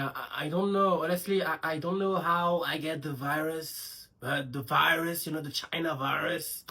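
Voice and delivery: slow, put-on French-accented English; lightly mocking English aside. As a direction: drawl it in a fake foreign accent. Switches to mock English.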